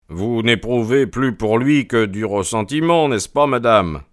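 lightly voiced detective questioning; a hint of a firm investigator; probing Holmes. A narrator's reading. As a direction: press gently, as the detective.

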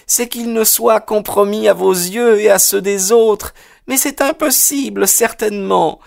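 high, agitated woman's voice; troubled mother. A narrator's reading. Mother defending her son to the detective, troubled and high. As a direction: plead the son's case in a high voice.

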